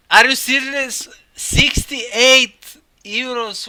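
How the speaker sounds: loud, high, stunned disbelief; can't believe the price, slow it right down. Real, not acted. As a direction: streamer shocked by a pricey gift, loud disbelief. Shocked at expensive gift.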